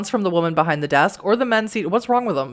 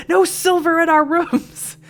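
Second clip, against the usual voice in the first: high, drawn-out mock outcry; mock alarm; 12 semitones higher, 4 dB louder, slower than usual. Players joking they must check for silver, mock alarm. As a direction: cry it out high, hamming up the alarm.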